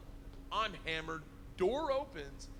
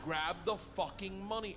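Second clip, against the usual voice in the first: slow, deliberate order; patient, heavy order, each word weighed; slow insistence; 4 semitones higher than usual. Retold or imitated. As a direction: spell it out like to a slow kid. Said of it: "He goes".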